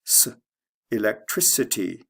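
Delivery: electricity with C said as s; soft C. Shown as a demonstration. Teacher showing the soft C, an s inside electricity. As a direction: isolate an s sound, then electricity with that s. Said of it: "to a soft C".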